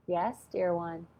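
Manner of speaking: a lightly voiced calm angel; soft angel voice. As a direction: answer gently like a kind spirit. Voicing the angels answering.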